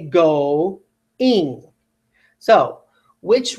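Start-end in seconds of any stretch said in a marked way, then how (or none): 0.0-1.6 GO-ING slowly, as two syllables, "go" then "ing"; separated vowels. Shown as a demonstration. Teacher correcting the blended version, GO-ING slowly split in two. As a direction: pull GO-ING apart with a clear gap. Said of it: "I say go-ing".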